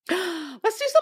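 breathy gasp then high, slow; thrilled to start the segment; mock-gasping eager start; gasping excitement. Real, not acted. Gasps, excited about segment.

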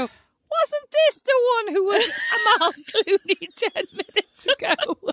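high, slow amused bouncer; mocking bouncer. Bouncer recognising her second attempt, high and slow. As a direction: stretch it out laughing at her. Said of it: "and they go".

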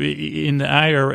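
ordinary talk, nothing marked

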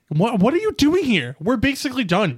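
usual speaking voice

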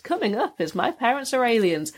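lightly voiced TV continuity announcer; a hint of a chirpy announcer read. Imitating the ITV announcer introducing the show, lightly voiced. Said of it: "continuity announcer basically said".

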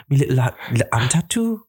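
Geordie accent. Shown as a demonstration. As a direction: do a comic Newcastle Geordie take on "me little tattoo". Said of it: "Can you do it?".

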